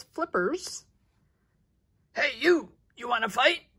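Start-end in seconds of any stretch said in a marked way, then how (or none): 2.1-3.6 shouting challenge. Acted. Ladybug shouts at whale flipper.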